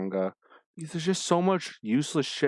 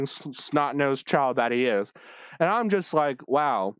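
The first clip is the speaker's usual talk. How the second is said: usual speaking voice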